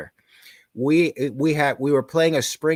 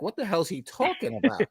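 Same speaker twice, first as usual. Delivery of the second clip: puzzled inner voice; 7 semitones higher, 4 dB quieter, faster than usual. Retold or imitated. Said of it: "I'm looking like".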